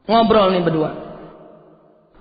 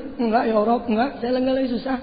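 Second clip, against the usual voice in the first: higher, quick imitation of tearful denial; tearful self-comfort; 6 semitones higher than usual. Malik consoles himself over his tea, imitated tearful self-comfort. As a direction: act out insisting through tears, pitched up. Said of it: "Sambil nangis tuh dia".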